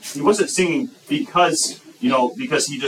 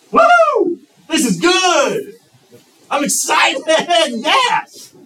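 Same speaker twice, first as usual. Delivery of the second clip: mock ecstatic shout, much higher and louder; excited shout; 7 dB louder, much slower than usual. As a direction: whoop it up like the best news ever. Acting out excited rejoicing.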